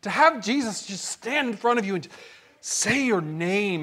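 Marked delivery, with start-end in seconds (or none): none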